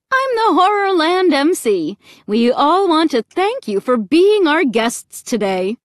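high, loud, gushing show host; cheery MC. A narrator's reading. Horrorland MC welcoming the family as guests, cheery. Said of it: "she said in a cheery voice".

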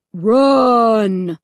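loud, drawn-out command; booming deep voice. A narrator's reading. Loudspeaker voice ordering victims to go, booming. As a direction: boom out one long order. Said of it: "the deep voice boomed over the loudspeaker".

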